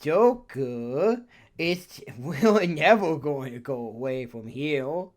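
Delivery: little cat creature voice; silly cutesy cat sidekick; Morgana voice. Retold or imitated. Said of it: "Explain the Morgana voice".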